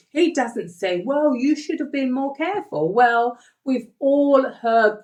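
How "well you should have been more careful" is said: mildly lecturing, pitched higher; mock rebuke. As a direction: imitate someone scolding a careless pupil. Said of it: "He doesn't rebuke or lecture him".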